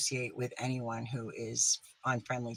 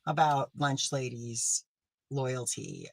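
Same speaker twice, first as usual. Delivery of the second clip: ordinary talk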